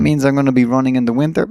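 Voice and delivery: ordinary delivery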